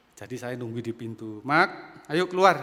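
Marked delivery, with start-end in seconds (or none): 1.4-2.6 loud call through the bathroom door. Retold or imitated. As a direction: call the child out, firm and loud. Ayah memanggil anak di kamar mandi. (ayah memanggil anak dari balik pintu kamar mandi, berseru keras)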